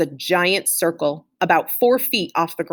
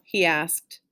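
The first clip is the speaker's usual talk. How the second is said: ordinary delivery, nothing marked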